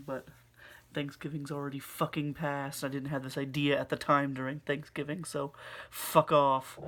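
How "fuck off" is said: mock snapping. Just kidding.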